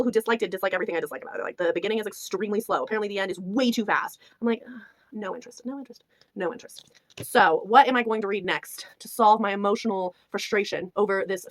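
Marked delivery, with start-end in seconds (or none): 4.7-6.9 very soft, dismissive repeating; mock disinterest. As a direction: drop the volume and lose all interest. Acts out her boredom with book.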